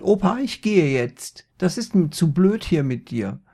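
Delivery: genervtes Mädchen. A narrator's reading. Maria geht genervt.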